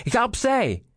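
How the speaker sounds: high-pitched, urgent order; bevelend roepen. A narrator's reading. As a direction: call out high to push a rival aside. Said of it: "riep Reinier". (hoog en dringend geroepen)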